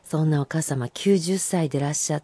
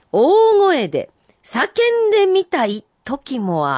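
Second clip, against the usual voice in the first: higher, slow, stressed 大声; emphatic recital; 8 semitones higher, 4 dB louder, slower than usual. Acted. Host reading a listener's haiku, emphatic. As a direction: lean into 大声 slowly.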